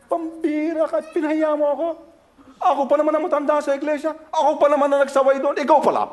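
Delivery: mock furious father; imitating angry father. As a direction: berate the son for shaming you. Father scolding son after church.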